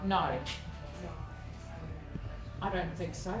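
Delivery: ordinary talk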